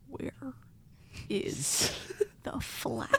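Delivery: slow whisper, long gaps between words; eerie sleep-talk whisper. Retold or imitated. Sleep-talking to Leah at night, slow eerie whisper. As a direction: whisper it haltingly, imitating a sleep-talker.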